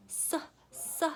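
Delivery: "S" said as soft sss; soft "S" as in circle; letter sound. Shown as a demonstration. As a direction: give "S" as unvoiced phonic suh, twice. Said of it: "letters make more than one sound".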